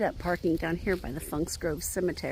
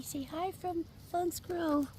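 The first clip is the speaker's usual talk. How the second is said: whispering; 9 semitones higher, 6 dB quieter than usual. Real, not acted. Voicing the dog's greeting softly.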